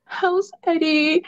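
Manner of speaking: high, soft, drawn-out mock sad voice; mock pouty. Podcaster imitating pouty Noah asking after Eddie, mock pouty. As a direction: imitate someone pouting, slowly and softly. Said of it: "sad ass fucking face".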